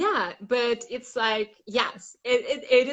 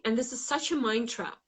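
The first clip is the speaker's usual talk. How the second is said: usual speaking voice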